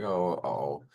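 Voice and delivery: low, subdued worried reaction; retold worry, pitched well down; worried mom. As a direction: imitate an uneasy mother taking in news. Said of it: "she just was a worried mom".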